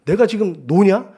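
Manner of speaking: high, loud indignant protest; arguing back. Retold or imitated. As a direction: re-enact a heated argument with your wife, voice climbing high. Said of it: "제가 막 따졌어요".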